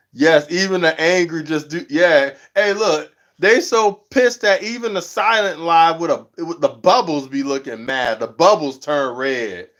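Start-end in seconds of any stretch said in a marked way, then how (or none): none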